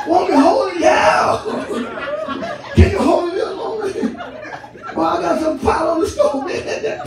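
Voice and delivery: wife's voice. Retold or imitated. Acted exchange with wife, shouted laughing.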